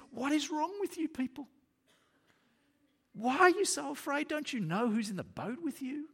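high-pitched, incredulous scolding; exasperated rebuke, acted. Jesus asking frightened disciples what's wrong, acted exasperation.

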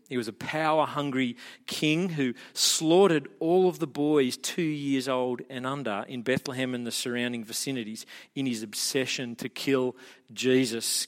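usual speaking voice

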